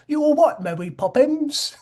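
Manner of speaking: high, loud cockney parody; mock a terrible Mary Poppins accent; hammed-up fake cockney; bad British accent. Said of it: "how terrible his British accent".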